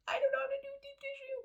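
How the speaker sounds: high, fast squeal of mock panic; tiny panicked voice, rattled off very high. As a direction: squeak it out in a comic fluster. Retelling her panic at customers' requests.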